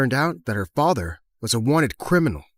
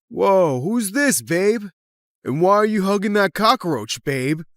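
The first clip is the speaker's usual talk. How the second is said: put-on higher-pitched jeering teen sneer; mocking rival; 5 semitones higher, slower than usual. Rival mockingly interrupts a hug.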